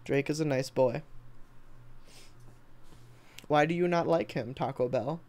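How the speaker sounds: ordinary talk, nothing marked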